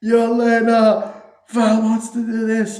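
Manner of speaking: high, loud, drawn-out bellowed call; Red Guardian. Retold or imitated. Father hollering to Yelena from the limo, hammed-up long shout. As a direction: yell the name out long, hammed-up. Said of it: "it's Red Guardian, and he gets out".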